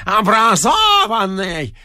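high, slow, mocking bellow; a raging janitor mocking the educated man; sneering rage. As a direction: drag out the word as an insult. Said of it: "неистовствовал дворник".

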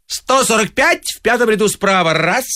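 high, ringing count of a bid; auctioneer's call. A narrator's reading. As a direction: call it out across the auction hall. Auctioneer calling bids.

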